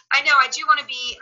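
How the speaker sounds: ordinary delivery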